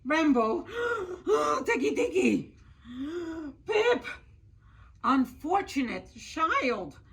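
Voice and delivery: breathless urgent plea. Acted. Brother's frantic report, mother's rebuke.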